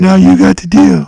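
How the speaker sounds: loud eerie catchphrase out of nowhere; spring the creepy voice to spook the friend. Retold or imitated. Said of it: "That's fucking creepy as shit".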